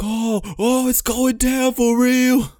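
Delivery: high, slow excited squeal; squeaky thrilled reaction; hyped disbelief. Retold or imitated. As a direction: stretch out the hype. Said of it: "that's at the moment I was like".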